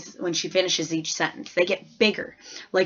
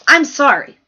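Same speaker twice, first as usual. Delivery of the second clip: loud, higher, flustered apology; startled teacher; 7 semitones higher, 11 dB louder than usual. A narrator's reading. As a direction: blurt it out caught off guard. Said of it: "Missus Smith said".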